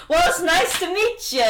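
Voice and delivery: loud, high, jovial welcome; hearty greeting. Acted. Merlin shaking Electra's hand, hearty loud greeting. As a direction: greet with full-force enthusiasm.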